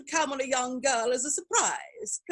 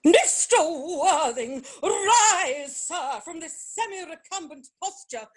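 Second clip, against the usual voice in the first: outraged command; 6 semitones higher, slower than usual. Acted. Lady Bracknell catches the couple.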